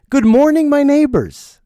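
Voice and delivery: hammed-up happy balcony call; happy prince greeting. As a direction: imitate an overjoyed newcomer greeting the street. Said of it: "He's so happy to be in New York City".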